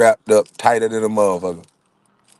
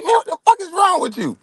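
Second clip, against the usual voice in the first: much higher, outraged shout at his friend; angry yell. Retold or imitated. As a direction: rage at the guy who pushed you. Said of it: "I said".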